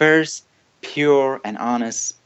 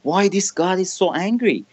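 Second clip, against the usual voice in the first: pitched way up, pleading why, bewildered and hurt; baffled protest; 9 semitones higher, faster than usual. Retold or imitated. Confused believer questioning an angry God.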